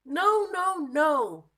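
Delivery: high, loud no no no; loud protest. Acted. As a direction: shout the denial. Monkey rejects wrong animal.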